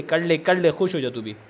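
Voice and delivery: ordinary talk, nothing marked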